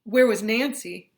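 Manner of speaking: usual speaking voice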